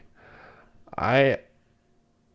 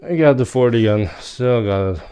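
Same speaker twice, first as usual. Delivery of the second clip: excited shout; 3 semitones higher, 10 dB louder than usual. Real, not acted. Gamer hyped after a lucky pull.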